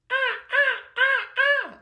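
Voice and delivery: very high, short monkey calls; monkey hoots. Acted. George says yes to next year, high monkey hoots. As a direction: squeak out eager monkey sounds.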